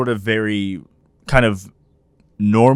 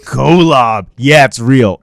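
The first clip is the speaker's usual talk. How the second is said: loud, emphatic announcement; unapologetic; 10 dB louder, much slower than usual. Retold or imitated. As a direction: state it boldly, as on the old show. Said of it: "that was the attitude".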